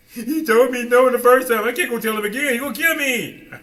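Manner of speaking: hammed-up scared Moses; mock fearful whine. Moses begging off the task, high and loud. Moses voiced, afraid of Pharaoh.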